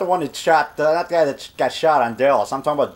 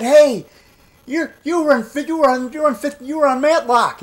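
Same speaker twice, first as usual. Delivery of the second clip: high, stammering fan outburst; starstruck excitement; 11 semitones higher than usual. Retold or imitated. Fan recognizing a TV actor, excited and high. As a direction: stumble over yourself, pitched way up.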